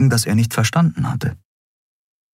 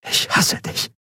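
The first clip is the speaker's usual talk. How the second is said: hushed, voiceless whisper; barely voiced whisper; 4 dB quieter than usual. A narrator's reading. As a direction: whisper the words with hurt. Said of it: "flüsterte sie".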